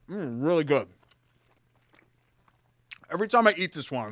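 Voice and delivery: talking while chewing; words round a bite of food; mouth full. Real, not acted. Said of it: "Don't talk with your mouth full".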